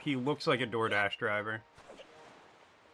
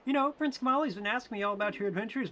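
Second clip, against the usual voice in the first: much higher, gentle female attendant voice; high motherly attendant; 9 semitones higher, faster than usual. Acted. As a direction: pitch way up as a fond caretaker talking to Mort.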